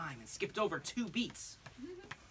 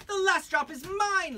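high, louder, slow haughty claim; high lady voice, drawn out; Lady Pancake claiming; 10 dB louder than usual. Acted. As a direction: stake your claim slowly.

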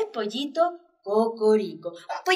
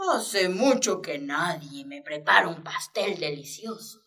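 sly bald cat; 6 semitones lower than usual. Acted. Bald cat spares the chick for half the cake, low and sly. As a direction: bargain like a greedy cat. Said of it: "pensó".

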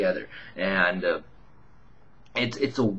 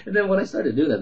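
ordinary talk

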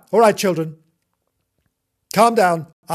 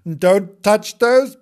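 slow, loud, higher scolding; stern warning; 8 semitones higher, 5 dB louder, much slower than usual. Acted. Gillian warns the cat off the pigeons, sternly. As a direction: lay down the law word by word. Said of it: "Gillian said Timbo was never to touch".

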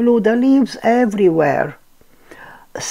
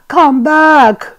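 calling out; 8 semitones higher, 7 dB louder than usual. A narrator's reading. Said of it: "Pooh said".

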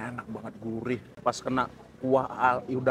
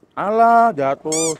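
surprised exclamation; 8 dB louder, much slower than usual. Real, not acted. Food falls off the spoon.

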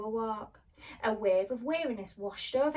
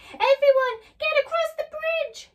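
high urgent yell, much louder; 11 dB louder than usual. Acted. Scarecrow yells to everyone to cross the bridge. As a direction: bellow the order to run. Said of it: "yelled the Scarecrow".

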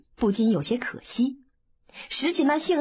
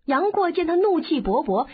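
ordinary delivery

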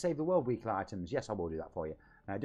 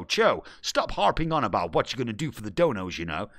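louder, mock voiceover man butting in; 4 semitones lower, 6 dB louder than usual. Streamer voices a voiceover man scolding himself, mock announcer. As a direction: play the narrator scolding him, louder. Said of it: "Shut up, voiceover man".

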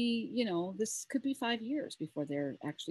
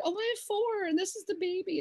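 teary reply; 13 semitones higher than usual. Retold or imitated. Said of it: "and I got tears".

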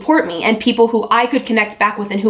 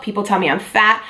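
ordinary talk

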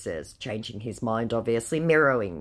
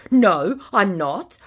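louder, slow put-on denial; mock indignant scammer; 6 semitones higher, 8 dB louder, slower than usual. Scammer denying mixed-up chats, mock indignant. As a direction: insist with fake offence.

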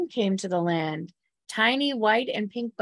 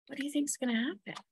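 hushed question; 5 semitones higher, 8 dB quieter, much faster than usual. Real, not acted. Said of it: "asks young listeners, hushed".

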